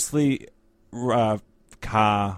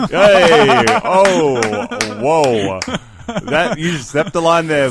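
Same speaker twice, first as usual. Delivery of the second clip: loud, high mock-offended yelling; mock outrage; 5 semitones higher, 11 dB louder, much slower than usual. Drummer called out by bandmate, loud mock-offended protest. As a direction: shout in pretend indignation at the joke.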